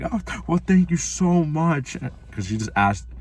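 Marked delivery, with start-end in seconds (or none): 0.0-2.0 high, slow sugary girl imitation; a hammed-up imitation of the girl at the lockers; mock girl voice. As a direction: play her, high and drawn out. Imitating the girl thanking him.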